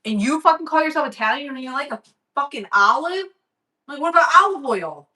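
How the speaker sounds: retold mad rant. Vlogger replaying her rant at Italian friend, indignant. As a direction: rant quickly in disbelief, pitch up. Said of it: "I was actually mad".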